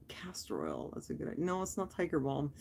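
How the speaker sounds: near-silent whisper to herself; muttering. Real, not acted. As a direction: whisper it under your breath.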